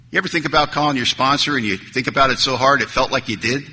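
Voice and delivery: ordinary delivery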